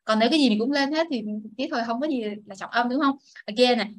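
ordinary talk

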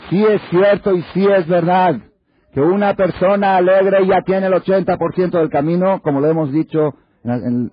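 loud, high, driving sermon voice; full-force preaching. Acted. Rabbi urging his listeners, in full-force preaching. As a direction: preach it out to the hall.

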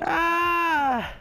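high, held yell; long high scream while running off; panicked scream. Pretend. Fleeing in game.

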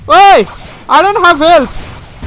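loud, high-pitched protest; urgent yell. Real, not acted. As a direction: yell it out in mid-fight alarm. Gamer in danger, low health.

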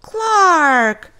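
high and loud call; shocked cry. Acted. Joey catching Clark breaking his toy, loud. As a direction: yell his name in dismay. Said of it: "cried Joey".

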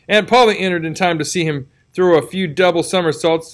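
plain reading voice

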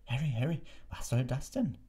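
high-pitched, agitated minister. A narrator's reading. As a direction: scold the boy in mild agitation. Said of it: "sagte Fudge aufgebracht". (aufgebracht, mit erhobener Stimme)

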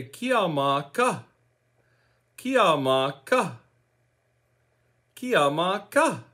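Kiamaka as kee-ah-mah-KA, slow syllables; teaching pronunciation. Shown as a demonstration. Teacher showing how to ask what about you, Kiamaka as slow kee-ah-mah-KA. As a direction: model "Kiamaka" slowly, with a crisp final KA. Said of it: "you say".

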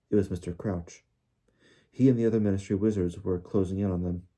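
ordinary delivery, nothing marked

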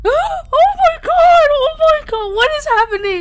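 loud, high-pitched freak-out; shocked shriek. Real, not acted. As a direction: shriek in disbelief, easing down slightly. Shocked reaction to trailer twist.

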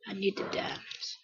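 hushed, voiceless craving; whispered plea. Pretend. Gamer longing for diamonds, whispered plea. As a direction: whisper it needily.

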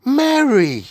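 high, loud call of the name; rather sharp. A narrator's reading. The Queen calls her maid after the tray falls, sharply. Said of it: "saying rather sharply".

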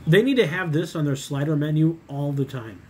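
chewing between words; speaking after a bite; mouth full while talking. Real, not acted. Seen 3: bite taken at -2, lips pursed chewing while speaking, slider held.

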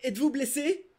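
high, urgent question; alarmed cry. Acted. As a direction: call out in sudden worry. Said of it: "ai-je crié".

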